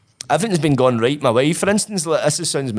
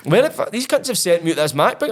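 much higher incredulous outburst; retold disbelief; 6 semitones higher than usual. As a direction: rise up in astonished disbelief. Retelling finding MacBook in box.

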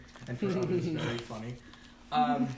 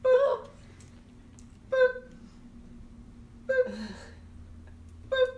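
high, loud beeping boops; scanner boops; 5 dB louder, slower than usual. Acted. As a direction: mimic a checkout scanner, spaced out. Shopkeeper scanning items aloud.

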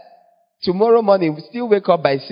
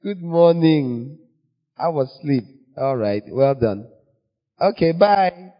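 gentle God voice; 2 semitones higher than usual. Retold or imitated. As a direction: greet the sleepy child like a delighted parent, slowing on good morning. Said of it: "He's like".

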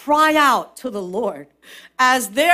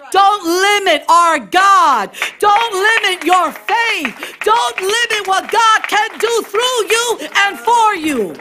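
full-voiced sermon proclamation, higher pitch; preach; 9 semitones higher, 4 dB louder than usual. Real, not acted. Preacher urging the congregation to receive it, preaching at full force. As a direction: call out the promises with force.

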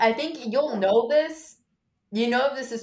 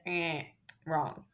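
"Eh, wrong" dropped much softer and lower; soft buzzer redo; 4 semitones lower, 7 dB quieter than usual. Shown as a demonstration. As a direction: mutter the buzzer "Eh, wrong" under the breath. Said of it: "Insert button sound effect".

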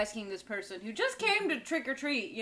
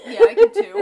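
ordinary talk

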